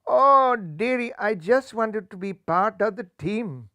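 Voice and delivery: sad plea. Acted. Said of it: "said Rajbun sadly".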